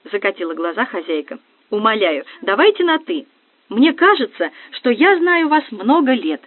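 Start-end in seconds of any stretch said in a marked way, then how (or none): 1.7-6.4 put-on high, bubbly girly gush; giddy party girl. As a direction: gush like a starstruck fan. Nastya gushing over guest.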